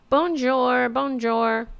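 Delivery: high, sing-song bonjour; mock French greeting. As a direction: imitate her greeting in a drawn-out singsong. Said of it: "she goes".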